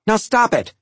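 high, loud exasperated order; yelled. A narrator's reading. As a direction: yell at squabbling kids to quit. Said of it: "Mom yelled".

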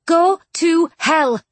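emphatic, separately spaced words; enunciated plainly. A narrator's reading. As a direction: spell it out for him deliberately. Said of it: "Charlotte enunciated plainly".

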